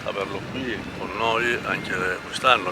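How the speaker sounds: normal talking voice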